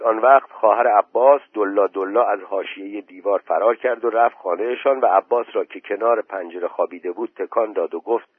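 ordinary delivery

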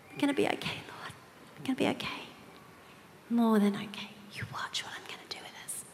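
whispered, prayerful and intimate; hushed prayer. Retold or imitated. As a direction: breathe it as a private whispered prayer. Retold prayer, hushed to herself.

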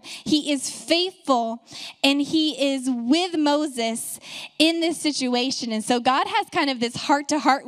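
full-force pulpit preaching; fervent preaching. Acted.

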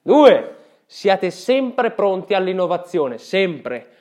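loud, emphatic pep talk; rally the listeners; motivational speech. Acted. Numbered life advice.

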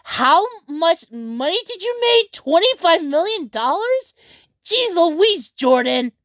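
high, stunned disbelief; incredulous. Retold or imitated. Reacting to Jordan's earnings, stunned high disbelief.

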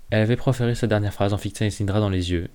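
ordinary delivery

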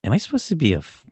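high-pitched incredulous question; mock bewilderment. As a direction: squeak it in mock offence. Retold mock bewilderment at athlete.

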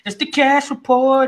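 slow, drawn-out segment intro; announcer voice. Acted. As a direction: announce it like a show title.

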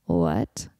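deep, loud confused what; baffled what. Retold or imitated. Friend baffled by a random question, loud deep what. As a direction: drop low and blurt a stunned what.

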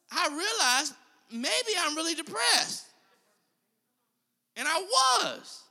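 rising preaching fervor. Acted. Preacher recounting his depression, rising fervor. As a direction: build the story up with fire.

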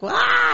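high startled yelp; startled scream. Retold or imitated. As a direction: cry out in fright, high. Kid startled by scary principal.

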